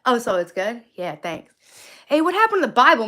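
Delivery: ordinary talk, nothing marked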